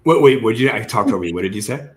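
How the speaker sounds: normal talking voice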